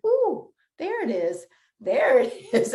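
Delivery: high delighted ooh, laughing through it; delighted relief. Retold or imitated. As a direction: squeal with relief as the good feeling arrives. Endorphins kick in mid-run.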